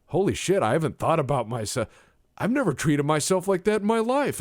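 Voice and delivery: high, loud imitation of a revelation; mock astonished. Man realising he never treats himself, mock astonished. Acting out friend's sudden realisation.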